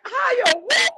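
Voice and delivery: high, loud disbelieving cry; shocked outcry. Real, not acted. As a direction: yelp it in shock. Shocked reaction to odd word.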